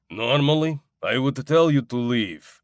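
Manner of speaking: put-on light Russian accent for the ice cream man; a hint of a gruff Russian vendor. A narrator's reading. Narrator voicing the Russian ice cream man, light Russian accent. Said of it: "he said in a Russian accent".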